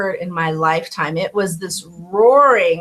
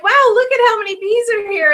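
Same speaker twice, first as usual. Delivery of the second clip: high, quick, wide-eyed wonder; mock amazement; 11 semitones higher, faster than usual. Bees arriving at the sugar water, high amazed voice. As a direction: gush like a dazzled bee. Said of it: "you're like".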